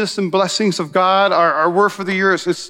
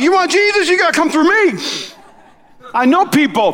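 east side tough guy; 10 semitones higher than usual. Retold or imitated. Peter guarding Jesus like a streetwise tough. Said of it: "Peter probably was from the east side".

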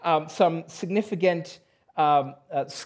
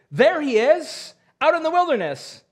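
mock false prophet; 9 semitones higher, 4 dB louder than usual. Quoting false claims about Jesus.

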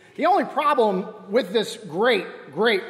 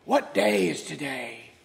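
put-on old Scrooge calling out; slower than usual. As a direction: hail the boy from the window like old Scrooge. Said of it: "And he said".

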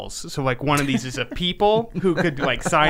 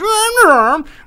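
high, very loud Grimace-style growl; wordless monster yell; 10 dB louder than usual. Retold or imitated. Grimace blindly reacts to owls, wordless yell. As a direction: roar out a high wordless noise.

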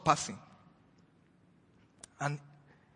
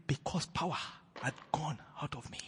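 hushed words of Jesus; 2 semitones lower, 10 dB quieter than usual. Acted. Jesus speaks softly.

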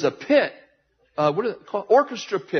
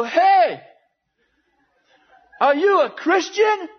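high, loud challenge; calling out; 10 semitones higher, 4 dB louder, slower than usual. Retold or imitated. Preacher confronting a guy against the wall, high loud call. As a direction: call him out, stretching the question slowly.

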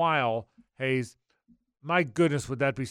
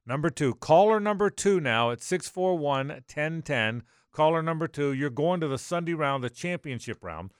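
radio announcer call-out; 3 semitones higher, 5 dB louder than usual. Acted. Radio giveaway call-out to listeners.

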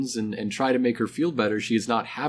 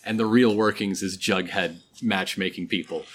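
ordinary delivery

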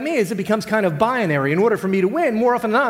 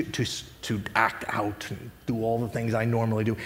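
quiet defiance; 12 semitones lower, 7 dB quieter than usual. Pretend. A believer quietly dismissing Satan's temptation, soft and low. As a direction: quietly refuse temptation, almost to yourself. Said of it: "what if you today just said".

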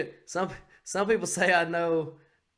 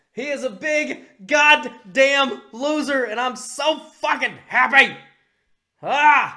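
gleeful shouting; 8 semitones higher, 8 dB louder, slower than usual. Real, not acted. Celebrating election win, yelling.

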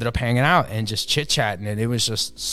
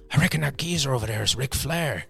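put-on Irish accent; 3 semitones higher than usual. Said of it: "he's this Irish guy".

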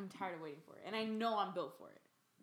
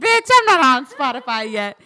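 very loud, higher-pitched indignant yell; triggered outburst; 4 semitones higher, 24 dB louder than usual. Real, not acted. Podcaster snapping at friend over Spotify, indignant shouting. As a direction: explode at a sore point. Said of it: "I'm triggered".

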